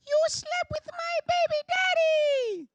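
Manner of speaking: very high, slow, put-on sweet voice; a prim housewife gently accusing; high, drawn-out mock politeness; mock reality-show accusation.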